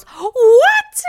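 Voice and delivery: one huge, high-pitched outburst; mock shocked shriek. Wife learning who watches the kids, mock shocked shriek. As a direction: blast it out in exaggerated disbelief.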